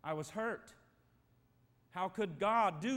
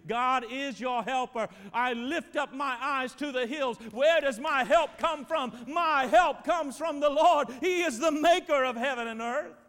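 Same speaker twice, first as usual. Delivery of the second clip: preaching at full force; 6 semitones higher, 12 dB louder than usual. Acted. Pastor proclaiming the psalm to his church, full-force preaching. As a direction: lift the verse up like a cry over the congregation. Peak of sermon, reading psalm.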